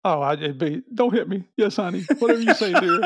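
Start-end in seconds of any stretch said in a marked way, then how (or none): 0.9-1.4 high pleading husband voice; meek henpecked husband. Pretend. 1.6-3.0 high, meek yes-dear voice; meek henpecked husband. Pretend.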